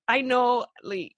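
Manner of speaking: ordinary talk, nothing marked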